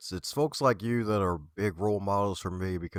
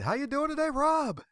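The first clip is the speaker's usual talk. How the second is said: host's high, eager welcome to the guest; excited greeting. Real, not acted. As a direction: pitch jumps much higher for the welcome. Host greets guest on air.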